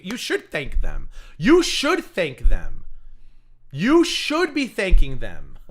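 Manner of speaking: loud, slow, stressed you should thank them; slow loud mocking lecture; sarcastic scolding. Real, not acted.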